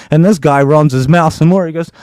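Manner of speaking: plain reading voice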